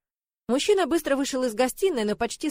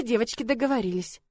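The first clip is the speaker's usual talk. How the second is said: ordinary delivery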